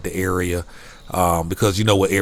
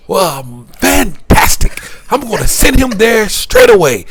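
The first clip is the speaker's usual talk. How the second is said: put-on Canadian accent; 13 semitones higher, 8 dB louder than usual. Clerk delighted a room was found, loud Canadian accent. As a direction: gush like the cheerful Canadian clerk. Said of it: "I can't make the accent".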